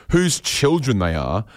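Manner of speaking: ordinary delivery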